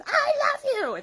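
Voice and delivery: imitating a girl's high-pitched shout; hammed-up movie yell. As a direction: yell it like an over-the-top film character. Quotes movie girl calling out.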